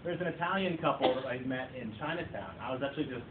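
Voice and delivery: ordinary delivery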